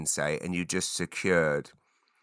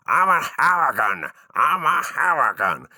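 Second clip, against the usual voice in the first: hammed-up Irish accent, higher, louder; mock Irish accent; 7 semitones higher, 6 dB louder than usual. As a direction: parody a bad movie Irish accent. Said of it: "that accent is he's doing".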